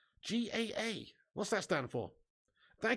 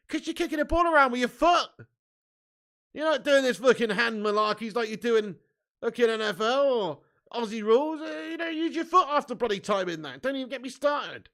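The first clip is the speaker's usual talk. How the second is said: high, loud, worked-up rant; exasperated rant; 5 semitones higher, 6 dB louder than usual. Real, not acted. Streamer lecturing Aussies on football's name, exasperated. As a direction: argue it like it's obvious, voice raised. Ranting about the word football.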